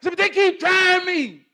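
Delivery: very loud, high-pitched protest; exasperated outburst. Acted. Answering his calmer self back, exasperated outburst.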